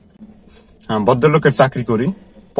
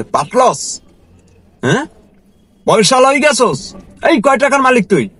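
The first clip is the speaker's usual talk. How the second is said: retold angry boss; 8 semitones higher than usual. Boss mocking his driver about money, high taunting scold. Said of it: "এই কথা আমাকে বলে যে".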